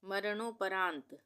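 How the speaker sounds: usual speaking voice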